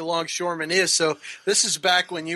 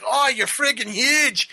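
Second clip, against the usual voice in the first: slow, higher amazed exclamation; 6 semitones higher, slower than usual. Retold or imitated. Meeting a huge army buddy, slow and amazed. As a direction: gape at how big he is. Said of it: "I was like".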